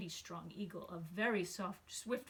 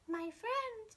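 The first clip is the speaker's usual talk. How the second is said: much higher, small far-off voice; tiny Who voice; 12 semitones higher than usual. Acted. The mayor of Whoville thanking Horton, tiny high voice. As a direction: squeak it up like someone no bigger than a speck. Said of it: "came the voice".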